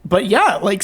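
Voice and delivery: normal talking voice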